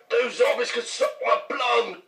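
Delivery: high-pitched fake British retort; mock British accent. British co-star scoffing at the zombies, high mock British accent. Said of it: "You'd be like".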